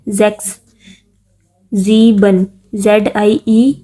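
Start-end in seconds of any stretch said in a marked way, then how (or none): 0.1-0.5 "زیکس" begun with a buzzing Z; S pronounced as Z. Shown as a demonstration. Teacher showing learners the German S, "زیکس" with a Z sound. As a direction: say "زیکس" with initial S voiced as Z. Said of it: "ایس جو ہے وہ زیڈ کی آواز نکالتا ہے".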